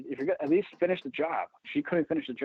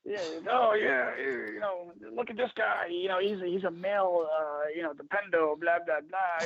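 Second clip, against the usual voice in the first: mocking ex-wife; 4 semitones higher, slower than usual. Mocking ex-wife's comment.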